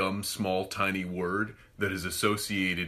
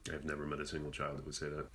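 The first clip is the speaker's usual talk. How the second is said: muttered rebuttal; 6 semitones lower, 12 dB quieter, faster than usual. Pretend. Other voice answers quietly.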